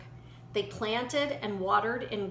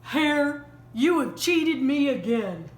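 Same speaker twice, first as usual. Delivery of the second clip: scowling bear; 2 semitones higher than usual. A narrator's reading.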